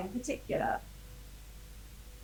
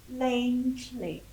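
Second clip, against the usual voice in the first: usual speaking voice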